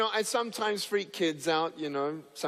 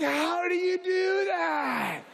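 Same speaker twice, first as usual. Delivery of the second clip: high, slow squeal of disbelief; hammed-up astonished question; mock amazement; 13 semitones higher, much slower than usual. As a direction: draw it out like a baffled passenger. Stunned passengers' question acted out.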